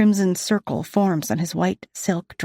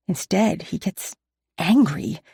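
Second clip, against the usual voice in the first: ordinary delivery, nothing marked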